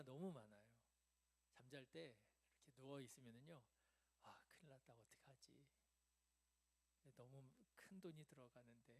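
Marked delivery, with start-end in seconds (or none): 4.2-8.9 worried self-talk. Acted. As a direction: fret under your breath, as if lying awake. Acts out lying awake worrying about money. (걱정하며 나직이 혼잣말하듯)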